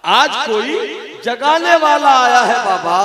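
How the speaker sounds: high, ringing, impassioned call; loud cry. Acted. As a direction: cry it out to wake everyone. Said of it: "इसीलिए तो मैं जोर से बोल रही हूँ". (ज़ोर से पुकारते हुए)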